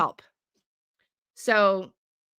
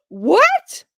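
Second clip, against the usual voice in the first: high, loud shocked cry; mock-shocked what; 10 semitones higher, 9 dB louder than usual. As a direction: gasp it out in disbelief.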